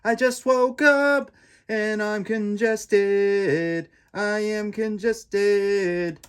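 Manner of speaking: high, slow put-on whine; hammed-up just-woke-up voice; mock groggy voice. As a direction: stretch it out high and sleepy like a stuffed-up kid. Jokey retake of waking-up line.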